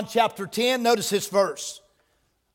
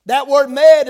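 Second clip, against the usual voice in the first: "mad" shouted out, much louder; preaching emphasis; 3 semitones higher, 8 dB louder, slower than usual. Acted. Preacher driving the word home to congregation, loud. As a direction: hit "mad" hard and loud. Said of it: "preacher hammering key word".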